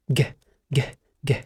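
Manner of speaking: ج as a short hard "g", each one separate; letter G sound. Shown as a demonstration. Teacher modelling G for a pupil, short hard sound. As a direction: say ج as a quick "geh", not the letter name. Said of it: "لا، ما بتنطقش كده".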